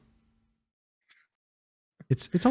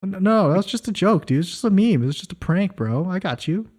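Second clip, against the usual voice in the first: mock defensive; 6 semitones higher than usual. Real, not acted. Playful denial, high pitch.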